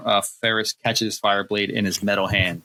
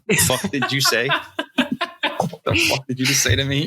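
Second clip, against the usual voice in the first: mock outrage; 5 semitones higher than usual. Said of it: "he just dropped the weight".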